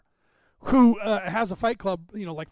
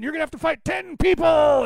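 much higher, louder wrestling-boss yell of "You're fired"; Vince McMahon shouting; 8 semitones higher, 6 dB louder than usual. Retold or imitated. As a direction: bellow "You're fired" like a heel boss firing everyone. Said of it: "he comes down and he's just".

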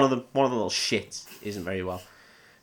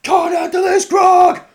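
much higher, much louder raucous orc bellow; orc shout; 12 dB louder, much slower than usual. Retold or imitated. Orc mocking the sick hobbit, a raucous shout. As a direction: roar it out like a drunk orc. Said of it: "turns to everyone and goes".

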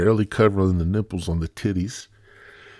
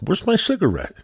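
demanding anchorman; 12 semitones higher than usual. Pretend. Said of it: "Tom Brokaw".

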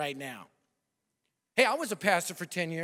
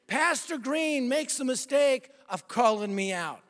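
ordinary talk, nothing marked

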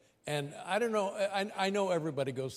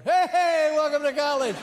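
booming high welcome, much louder; loud cheery greeting; 10 semitones higher, 8 dB louder than usual. Retold or imitated. Student helper greets a new family, loud and cheery. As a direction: shout a hyped welcome at arriving cars. Same greeting again, second lap.

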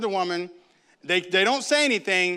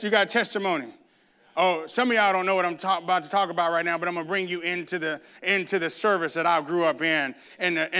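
normal talking voice

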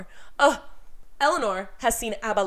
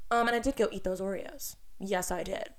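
ordinary talk, nothing marked

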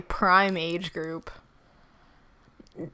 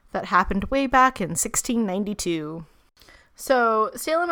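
normal talking voice

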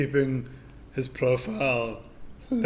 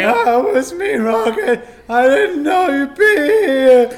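put-on much higher, loud gushing kid; excited teen; 13 semitones higher, 12 dB louder, slower than usual. Teen hero greeting Rocket, high and squealing. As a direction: squeal it out in delight.

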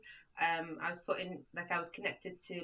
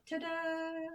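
higher, drawn-out ta-da; playful reveal; 8 semitones higher, 5 dB quieter than usual. Retold or imitated. Teacher answering how she brainwashes pupils, playful flourish. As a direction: present it like a magic trick.

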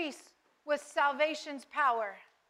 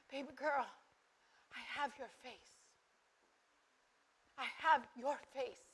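tender father's comfort; 3 semitones lower, 9 dB quieter than usual. Retold or imitated. Father God comforting his daughter.

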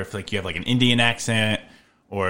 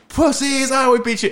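high, jeering boast through laughter; hammed-up bully jeer; mock taunting; 14 semitones higher, 4 dB louder than usual. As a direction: gloat at them in a raised voice. Imitating campers taunting the British.

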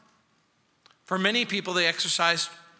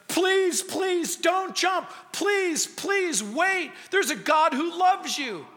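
mock-frantic high plea to a jumper; 10 semitones higher, slower than usual. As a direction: beg him desperately not to jump. Pleading man on bridge.

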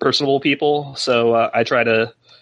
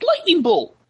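high-pitched imitation of a screaming larper; mock scream. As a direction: mimic a spell yelled in earnest, without full volume. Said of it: "just screaming".

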